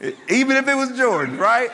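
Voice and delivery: a laughing, fast, disbelieving aside to the crowd. Real, not acted. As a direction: break into laughter mid-line. Laughing at his own story.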